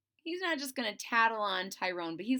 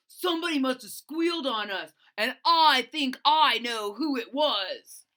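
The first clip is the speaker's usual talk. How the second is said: fast, loud, higher accusing voice; suspicious accusing bully; 4 semitones higher, 8 dB louder than usual. Acted. Tyrone accusing his gang of squealing, fast and loud. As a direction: point the finger at the traitor.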